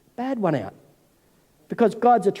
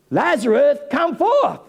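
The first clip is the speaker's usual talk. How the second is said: raised, higher-pitched summons; commanding call; 5 semitones higher than usual. Retold or imitated. Jesus calls dead Lazarus out of the grave, commanding call. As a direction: call him out, pitch climbing on the last words.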